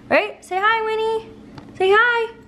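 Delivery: high sweet coax. Real, not acted. Owner getting the puppy to greet, high coo.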